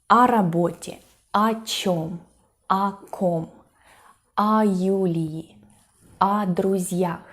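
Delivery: each "о" said like A and joined to the following word; careful list, every "о" reduced to an A syllable; preposition joined on. Shown as a demonstration. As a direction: read "о работе", "о чём" as single words starting with A. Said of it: "join these words together".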